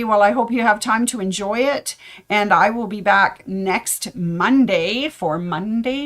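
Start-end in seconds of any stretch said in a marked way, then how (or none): none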